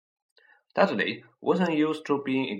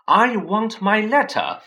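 loud, higher, slow shout; 10 semitones higher, 9 dB louder than usual. A narrator's reading. Harry shouts at Uncle Vernon for his letter. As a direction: demand it at full voice. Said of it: "he shouted".

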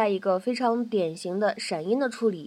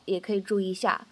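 ordinary delivery

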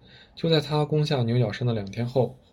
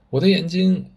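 louder, higher howl of pain; anguished howl; 6 semitones higher, 5 dB louder than usual. A narrator's reading. Bandit chief wounded in the eye, howling in pain. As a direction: cry out as the eye is lost. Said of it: "大当家哀嚎一声".

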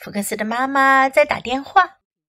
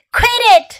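high, loud, exasperated command; crying out; 10 semitones higher, 6 dB louder than usual. A narrator's reading. Fox to misbehaving Ling kids, sharp cry. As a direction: shout to stop the mischief. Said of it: "cried Fox".

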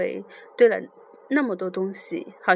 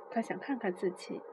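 murmured; 10 dB quieter than usual. A narrator's reading. Boy speaking very close to the narrator, murmured. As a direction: say it softly, as if close by. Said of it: "他在离我很近的地方小声说着".

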